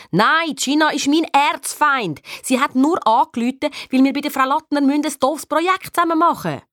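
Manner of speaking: high, emphatic protest; exasperated denial. Acted. Nick hotly denies liking Gina. (genervt abstreitend)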